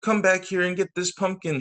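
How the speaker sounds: yelling. Acted. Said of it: "she yelled".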